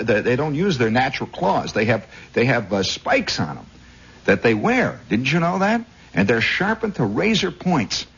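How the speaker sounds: ordinary talk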